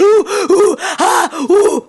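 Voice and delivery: high, loud hammed-up panting moans; mock hyperventilating demo; fake panic breaths. Shown as a demonstration. Demonstrating her faked panic attack to friend, high hammed-up panting. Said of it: "I'm making it up that it's like".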